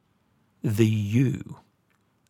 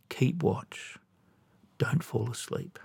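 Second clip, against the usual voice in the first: whisper; 9 dB quieter than usual. Acted. Sermon, urging watchfulness.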